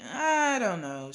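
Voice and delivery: drawn-out, doubtful reply; hesitant mother. A narrator's reading. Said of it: "My mother hesitated".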